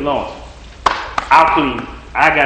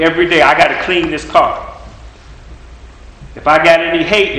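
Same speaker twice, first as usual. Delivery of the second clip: ordinary delivery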